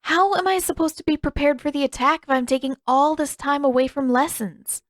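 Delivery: put-on mild girlish pout. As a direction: pout the question at her sparring partner. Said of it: "There was an audible pout in her voice".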